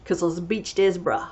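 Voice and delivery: put-on a light New Zealand accent. Telling viewers about the delivery guy, mild New Zealand accent. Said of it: "do a New Zealand accent again".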